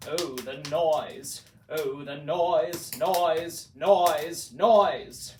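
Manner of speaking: building to loud. A narrator's reading. Reading the Grinch's hated noise, building to a shout. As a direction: swell from plain to a loud cry.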